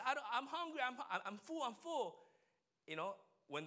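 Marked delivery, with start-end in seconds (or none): 0.0-2.2 put-on high, loud child's voice insisting he's full; pouty little boy, a touch louder; whiny little kid. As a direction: play the fussy toddler who claims he's full. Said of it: "even though he says".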